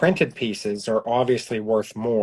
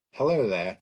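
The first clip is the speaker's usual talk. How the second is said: Obi-Wan impression; 3 semitones lower, 4 dB quieter than usual. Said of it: "oh, Obi-Wan".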